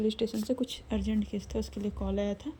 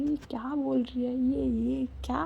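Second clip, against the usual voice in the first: shocked thought; 4 semitones higher, slower than usual. Retold or imitated. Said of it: "Arya को shock पे shock".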